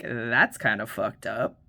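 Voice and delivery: low, slow deadpan remark; lightly voiced deadpan ex-boyfriend; imitating the boyfriend. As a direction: drop the voice low and slow, dry.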